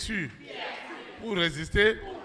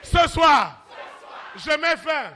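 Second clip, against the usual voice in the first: full-force preaching; 7 semitones higher than usual. Acted. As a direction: thunder against the devil.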